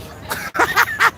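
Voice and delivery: a sudden high cackle at his own joke; high burst of laughter. Real, not acted. Laughing at his own pie analogy after telling it. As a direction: laugh high and bright, then stop.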